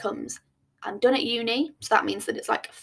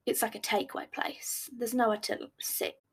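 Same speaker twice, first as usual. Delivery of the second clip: hushed, unvoiced confiding whisper; whispered aside; 6 dB quieter than usual. Real, not acted. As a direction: lean in and whisper it.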